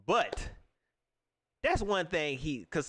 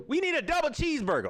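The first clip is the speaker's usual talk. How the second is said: higher, louder call; calling from back; 10 semitones higher, 3 dB louder than usual. Pretend. Fast-food boss calling out an order.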